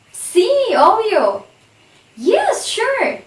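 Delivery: acted eager yes to being counted in; enthusiastic yes. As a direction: gush yes like you can't wait. Role-played enthusiastic yes answer.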